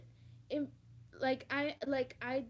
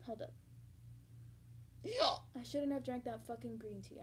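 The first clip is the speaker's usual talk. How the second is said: ordinary delivery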